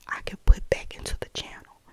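usual speaking voice

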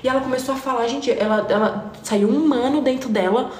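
ordinary delivery

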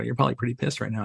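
quick teasing aside from the crew; crew teasing quickly. Retold or imitated. Crew ribbing the trainer, said fast and light. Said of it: "And they go".

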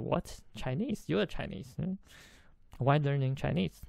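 softly puzzled disbelief, rising at the end; surprised. Retold or imitated. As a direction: imitate a baffled man questioning what he heard. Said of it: "So, so surprised".

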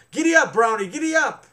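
loud high giddy up; girl urging her horse loudly; urging call. A narrator's reading.

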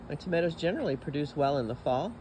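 ordinary talk

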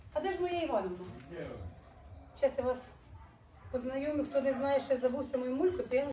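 normal talking voice

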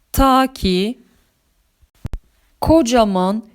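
plain reading voice

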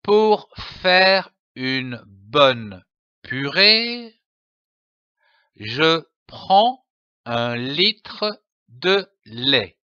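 put-on slow, careful read-aloud for children; slow syllable reading. Shown as a demonstration. Teacher reading slowly to young children. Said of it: "Je lis une phrase".

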